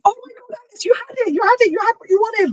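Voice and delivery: high, thrilled gush of disbelief; overjoyed exclaiming. Retold or imitated. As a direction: squeal it out like it's your first birth. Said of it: "As if I'd never done this before".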